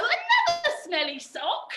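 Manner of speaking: high, slow comic wail; drawn-out hammed-up lament; mock dismay. Trick fails again, mock dismay.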